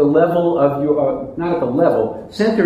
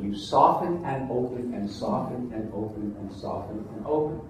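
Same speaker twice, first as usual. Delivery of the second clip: soft guiding voice; 5 semitones lower, 12 dB quieter than usual. Shown as a demonstration. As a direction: soften and open said much softer, almost hushed. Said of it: "I call it softening and opening".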